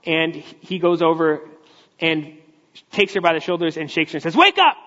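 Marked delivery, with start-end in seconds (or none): 4.4-4.8 sudden loud, high bark of a shout; rough wake-up shout. Retold or imitated. Shrek shouting at Fiona to wake her, a loud rough yell. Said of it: "Like that".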